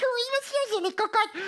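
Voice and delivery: very high little puppet voice; eager puppet character. Pretend. Cookie tries another wrong word, high eager puppet voice.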